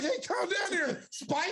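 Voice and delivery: a hint of calming a hothead, high and slow; mock calming. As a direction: soothe him mockingly, high and slow. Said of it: "I was like, I was like".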